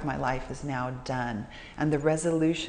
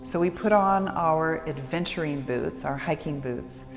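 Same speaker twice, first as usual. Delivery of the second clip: guided meditation; 2 semitones lower, 3 dB quieter than usual. Acted. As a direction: lead them gently into stillness with long pauses.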